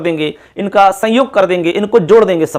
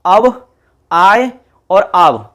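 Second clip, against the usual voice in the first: slow recitation; much slower than usual. Shown as a demonstration. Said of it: "इन चारों को क्रम से".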